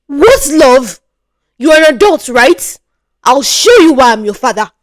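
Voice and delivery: mock her, then threaten. Father to daughter, sneering and loud.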